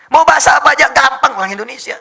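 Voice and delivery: loud, high-pitched proud claim; rousing boast. Real, not acted. Preacher praising Indonesians to the crowd, loud rousing boast. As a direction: proclaim it to the whole hall.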